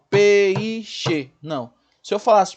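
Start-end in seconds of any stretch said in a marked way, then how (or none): 0.1-1.3 "peixe" partido em pe-i-xe; faça do I de "peixe" uma batida à parte; três sílabas. Shown as a demonstration. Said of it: "Eu não falo". ("peixe" devagar como pe-i-xe)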